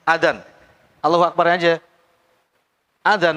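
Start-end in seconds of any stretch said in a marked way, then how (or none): none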